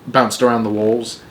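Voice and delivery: ordinary delivery, nothing marked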